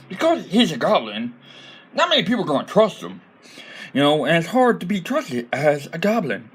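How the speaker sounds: high, silly goblin voice; goblin accent. Shown as a demonstration. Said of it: "all goblins sound like this".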